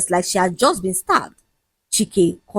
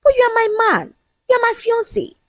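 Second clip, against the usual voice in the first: high-pitched indignant insistence; heated high protest; boiling anger; faster than usual. A narrator's reading. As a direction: protest with pitch pushed right up. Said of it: "felt her blood boiling".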